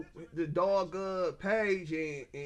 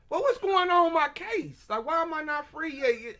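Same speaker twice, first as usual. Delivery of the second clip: high, loud, indignant questioning; retold demand to the lawyer, high and loud; exasperated demand; 10 semitones higher, 6 dB louder than usual. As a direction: push the question high and loud like you're fed up. Retelling his own demand to attorney.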